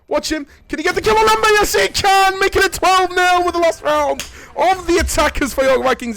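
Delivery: high, loud shouted commentary; thrilled play-by-play. Real, not acted. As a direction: erupt as the kills land. Esports play-by-play.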